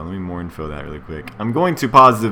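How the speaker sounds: ordinary delivery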